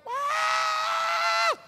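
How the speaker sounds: high, loud shriek of shock; wife's scream. Retold or imitated. Wife screaming from inside the house at the money. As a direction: shriek as if you found something shocking. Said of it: "istri saya di dalam teriak".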